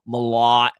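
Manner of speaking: Malott in a New England accent. Shown as a demonstration. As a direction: drop the t in Malott, Boston style. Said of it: "I gotta say with a New England accent".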